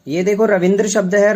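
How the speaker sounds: usual speaking voice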